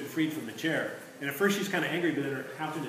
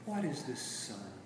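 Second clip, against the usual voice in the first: sleepy, soothing witch coo; cooing softly; 5 semitones lower, 8 dB quieter than usual. A narrator's reading. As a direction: purr it like a wood pigeon. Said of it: "The witch's voice came cooing softly".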